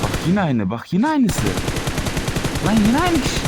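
exasperated yell at the same guy again; climbing indignant cry "lan yine aynı kişi"; building outrage. Real, not acted.

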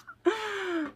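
sharp high gasp of delight. Real, not acted. Fan opening a sticker from a friend, delighted gasp. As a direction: gasp at the surprise.

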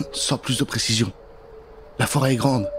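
usual speaking voice

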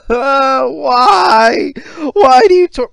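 loud, high wailing cry; hammed-up sobbing why; mock crying. Mock despair.

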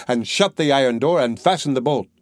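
usual speaking voice

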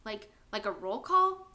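slow, stumbling, fearful question; backing away in dread; frightened, stammering. Acted. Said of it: "He looked even more frightened".

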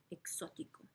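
soft unvoiced whisper of Exótico; a hushed whispered Exótico. Shown as a demonstration. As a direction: whisper the word to the class. Whispered repeat for class.

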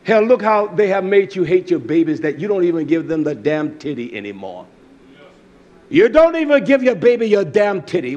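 usual speaking voice

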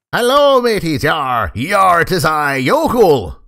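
loud hammed-up pirate greeting. A vampire pirate greeting his crew, loud and hammy. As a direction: growl out yar like a stage pirate. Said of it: "I'm a vampire pirate now".

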